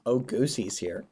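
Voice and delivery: ordinary delivery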